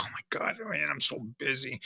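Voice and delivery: hushed, dismissive "so busy"; brushing his daughter off under his breath; muttered brush-off. Retold or imitated. Dad muttering he's too busy to his daughter. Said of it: "I would like".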